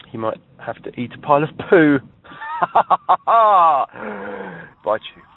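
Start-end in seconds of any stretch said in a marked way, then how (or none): 2.4-3.2 high laugh. Real, not acted. Laughing at own joke.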